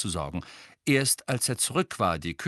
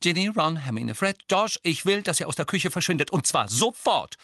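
higher-pitched maternal command; stern order; 8 semitones higher, 3 dB louder than usual. A narrator's reading. Mrs Weasley sending the children from the kitchen, commanding. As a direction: order them all out at once.

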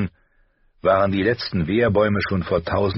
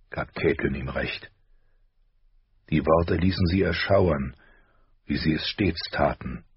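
plain reading voice

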